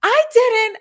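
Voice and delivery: high, loud quick denial; mock indignant teacher denial. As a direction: snap back defensively. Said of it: "And she goes".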